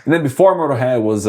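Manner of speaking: normal talking voice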